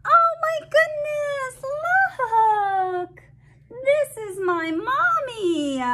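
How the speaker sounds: overjoyed fish voice. Acted. Fish finds its mommy.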